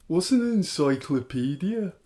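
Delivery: hammed-up Birmingham accent; Grealish. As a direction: ask it as a baffled Brummie.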